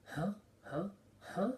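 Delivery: short, high questioning huhs; imitated puzzlement. Acting out characters as they walk away, high puzzled huhs. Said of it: "They're like".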